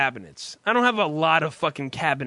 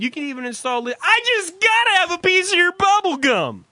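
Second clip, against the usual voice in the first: excited salesman; 7 semitones higher than usual. Retold or imitated. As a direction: ham up the pushy salesman. Salesman quoted in a story.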